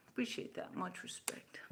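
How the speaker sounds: unvoiced, soft thanks; whispered thanks. Real, not acted. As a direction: murmur gratitude aside. Thanking commenter softly.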